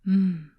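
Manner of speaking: a loud, pleased mmm of taste; savoring mmm. Acted. Witch tasting the tavern keeper's stew, pleased mmm. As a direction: hum your delight at the food.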